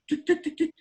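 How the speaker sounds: high clicking tic noises; imitated tic clicks. Friend mimicking his own tic sounds, high clicking. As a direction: click your tongue like a nervous tic. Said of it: "he goes".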